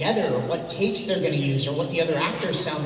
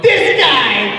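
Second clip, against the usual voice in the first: excited; 7 semitones higher, 12 dB louder than usual. Retold or imitated. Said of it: "they're like".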